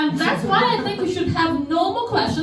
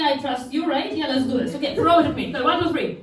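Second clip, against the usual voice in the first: ordinary delivery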